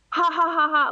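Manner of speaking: high mock "ha ha" laugh; spoken ha's, pitched high; imitated typed laughter. Reading out a friend's texted laughter, mock laughing. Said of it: "she starts writing".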